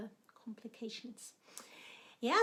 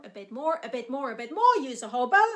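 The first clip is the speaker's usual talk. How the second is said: building to louder with each a bit more; growing louder; 10 dB louder, faster than usual. Shown as a demonstration. Teacher building volume to show the crescendo. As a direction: swell it up to the top of the phrase. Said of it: "you start very little".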